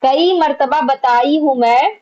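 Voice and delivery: usual speaking voice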